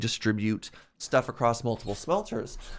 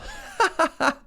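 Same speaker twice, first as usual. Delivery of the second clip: quick high-pitched laugh; 4 dB louder than usual. Real, not acted. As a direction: burst into a short high laugh. Laugh after question.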